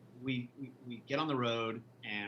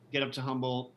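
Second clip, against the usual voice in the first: ordinary talk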